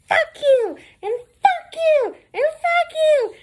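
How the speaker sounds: loud, very high screeching caricature; mad, cussing everybody out. Retold or imitated. Supervisor cussing out everybody, mocked shrieking tantrum. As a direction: hurl each one in an angry falsetto. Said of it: "that she got mad".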